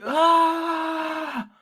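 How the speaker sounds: long, high-pitched "Ah"; high sustained comic wail; mock scream. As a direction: imitate a drawn-out high scream. Mimicking someone startled, "He's like.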